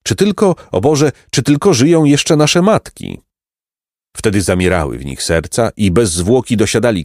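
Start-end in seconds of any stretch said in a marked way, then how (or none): 0.0-3.2 a higher, worried plea to God; anxious cry. Acted. Travellers fearing for their mothers, anxious. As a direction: fret aloud for their mothers.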